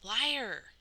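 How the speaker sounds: high, loud furious shout; boy shouting liar at Cole. A narrator's reading. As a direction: hurl the accusation. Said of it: "shouted Peter".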